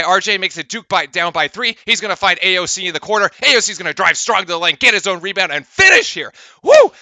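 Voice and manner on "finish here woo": higher, louder whoop; triumphant cheer. Real, not acted. Commentator celebrating a putback, whooping.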